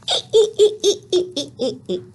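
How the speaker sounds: high-pitched "ee-ee-ee" giggle falling away; Happy Y's giggle. Acted. Teacher voicing a story character's laugh, high giggle. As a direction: giggle brightly on a repeated long E. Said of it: "she made this sound".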